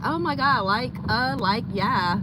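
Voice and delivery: put-on high bubbly valley girl gush. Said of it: "sounding like a valley girl".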